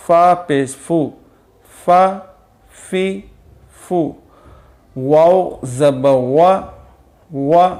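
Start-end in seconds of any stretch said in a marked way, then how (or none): 5.0-6.6 واو زبر وا with the lips rounded into a circle; rounded lips on vowel. Shown as a demonstration. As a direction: shape وا with round lips, slowly. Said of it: "جب واو بولیں گے تو ہماری جو زبان ہے یہ تھوڑی سی گول ہوگی". (ہونٹ گول کر کے آہستہ واو زبر وا)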